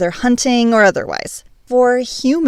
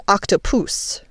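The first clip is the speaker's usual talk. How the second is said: put-on Greek accent; 4 dB quieter, slower than usual. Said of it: "forgive me for a terrible Greek accent".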